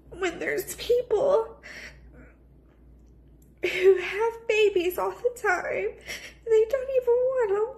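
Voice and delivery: crying through every word; snotty crying. Real, not acted. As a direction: voice breaking, high and teary. Tearful talk about infertility.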